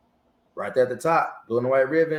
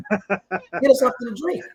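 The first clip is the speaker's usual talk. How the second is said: high-pitched commander voice laughing through it; teasing general; 11 semitones higher, 3 dB louder than usual. Retold or imitated. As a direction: tease him with a laugh, voice pitched up. General ordering drinks, laughing.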